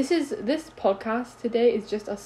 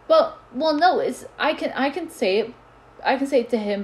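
mock defensive girl; 4 semitones higher, 6 dB louder than usual. Mocking the girl's excuse.

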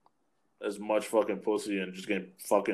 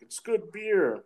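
slow defensive reply; 9 semitones higher, much slower than usual. Retold or imitated. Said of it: "I was like".

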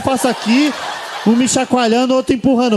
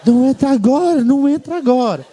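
higher, urgent pleading warning, repeated; dogs' warning voice; 5 semitones higher than usual. Pretend. Dogs warning the comedian not to enter, higher urgent pleading. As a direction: beg him not to come in, pitched up. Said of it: "tentando me avisar".

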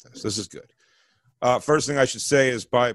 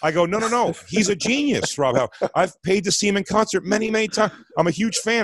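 higher, hurried backpedal to smooth it over; panicked backpedaling; 8 semitones higher than usual. Retold or imitated. Comic to an offended rock singer, higher panicked backpedal. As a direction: scramble to take the joke back. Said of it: "I start immediately backpedaling".

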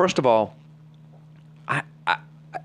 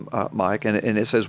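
ordinary delivery

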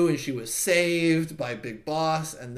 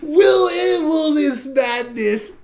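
high, loud, very slow hammed-up wail; mock crying; 11 semitones higher, 8 dB louder, much slower than usual. Otacon crying over Sniper Wolf's death, hammed-up sobbing wail. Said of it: "Otocon's just crying".

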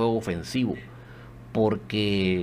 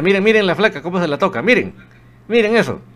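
rising, louder and higher with each miren; excited commentary; 8 semitones higher, 7 dB louder than usual. Real, not acted. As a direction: urge everyone to look, thrilled. Replay of a great play.

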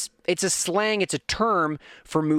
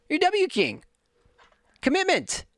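mock high jab; 12 semitones higher than usual. Mocking himself, high squeak.

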